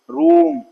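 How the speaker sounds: रूम with a long ऊ, called out high and loud; long oo demo. Shown as a demonstration. Teacher calls out रूम to students, holding the long ऊ. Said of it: "long ऊ".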